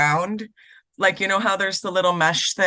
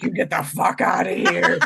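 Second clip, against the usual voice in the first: angry yell; 2 semitones lower than usual. Retold or imitated. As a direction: throw the cat out with a yell. Yelling at cat, slamming door.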